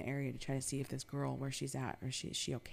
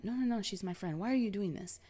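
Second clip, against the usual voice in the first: mock protest, pitched up high; imitated indignant protest; 6 semitones higher, 5 dB louder, faster than usual. Man batting her hand away from his friend, imitated protest. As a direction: imitate a man insisting and objecting.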